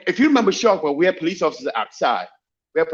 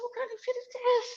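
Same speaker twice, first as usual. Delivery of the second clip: shocked; 10 dB quieter than usual. Retold or imitated. Shocked at fake card.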